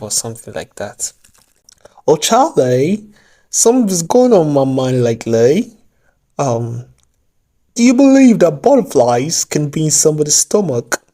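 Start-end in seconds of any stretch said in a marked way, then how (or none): 2.1-11.0 hammed-up mock British accent, higher and louder; fake British accent. Vlogger teasing viewers in a fake British accent. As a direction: play a swooning Brit with a British accent. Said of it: "Don't mind my fake British accent".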